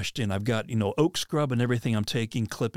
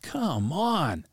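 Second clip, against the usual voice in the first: drawn-out, higher-pitched mock exasperation; exasperated protest; 5 semitones higher than usual. Hunter protesting to the voice about going left, exasperated. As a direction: plead in exaggerated frustration.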